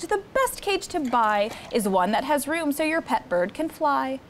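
plain reading voice

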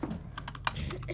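whispered slowly in awe; awed whisper. Real, not acted. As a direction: breathe "Replica." out slowly, impressed. Repeats word in awe.

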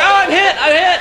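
high, hammy cry of a shot soldier; hammed-up wounded soldier. Comic acting out a war-movie soldier, hammy wounded wail. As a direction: clutch the leg and wail like a hit soldier.

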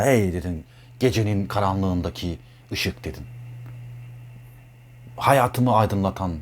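woo her like a stage Romeo; romantic Romeo. Retold or imitated. A lover praising her eyes, soft and dreamy. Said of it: "Romeo Juliet'teki gibi duyguya girdin".

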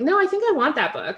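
lightly voiced keen reader pushing back; insistent reader. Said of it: "people were like".

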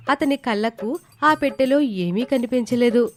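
ordinary delivery, nothing marked

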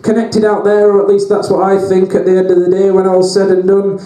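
loud, driving monologue over the beat; ranting performance poem. Acted. Poet at a comedy gig, ranting to the crowd, loud and relentless.

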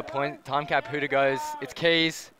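usual speaking voice

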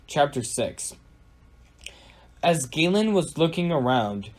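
ordinary delivery

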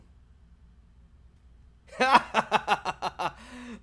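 loud high burst of laughter; real laughing fit. Real, not acted. Laughing at chat message.

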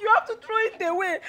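ordinary talk

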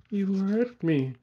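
high, slow, pained cry; moaned in pain. A narrator's reading. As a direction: moan it high, drawn out. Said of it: "She moaned".

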